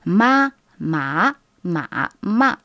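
ma, ma, ma, ma through the four Mandarin tones in order; four tones drill. Shown as a demonstration. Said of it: "une technique ... les quatre tons". (exercice des quatre tons sur ma)

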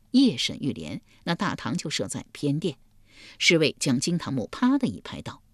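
usual speaking voice

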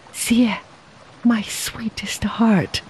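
tender, slow, half whispered; glad and sad. A narrator's reading. Lover greets Xie, gently bittersweet. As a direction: say it glad yet sorrowful. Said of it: "his voice both glad and sad".

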